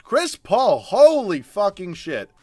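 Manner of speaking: high, loud stunned outburst; shocked exclamation. Real, not acted. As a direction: blurt out amazed disbelief. Star player's stats revealed.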